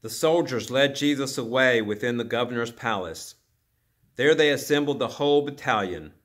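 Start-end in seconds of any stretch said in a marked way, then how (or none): none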